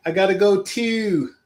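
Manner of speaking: mock Baltimore accent on "go" and "too". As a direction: stretch the O sounds the Baltimore way. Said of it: "when I was watching The Wire".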